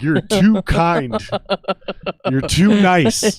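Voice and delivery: mock New Yorker telling him off; slow New Yorker verdict. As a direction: drag each word out.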